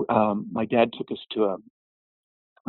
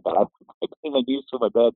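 normal talking voice

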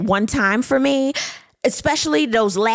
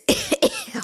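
burst of laughter; 3 dB quieter than usual. Real, not acted. Laughs mid-recap.

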